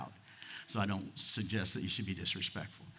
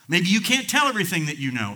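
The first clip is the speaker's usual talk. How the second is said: loud, raised pulpit voice; fervent preaching; 7 semitones higher, 8 dB louder than usual. Acted. Preacher urging the congregation to keep confidences, fervent. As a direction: drive the point home at full force. Preacher builds to full force.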